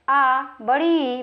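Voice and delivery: long vowels held out slowly; drawn-out vowels. Shown as a demonstration. Teacher stretching long vowels for students. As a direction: stretch each vowel, आ and बड़ी ई, to double length. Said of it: "वो कौन कौन है?".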